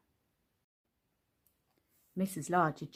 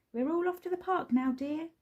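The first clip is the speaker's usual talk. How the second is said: higher, fast cheery announcing; cheerful announcement; 9 semitones higher than usual. A narrator's reading. Dad telling his wife they're going out, fast cheery. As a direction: announce it brightly. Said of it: "he announced".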